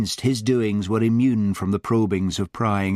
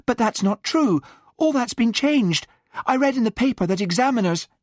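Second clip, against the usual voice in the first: high, pleading Alison; desperate; 14 semitones higher than usual. A narrator's reading. Alison argues desperately with the examiner. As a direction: rush it out, desperate and pitched up. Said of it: "began Alison desperately".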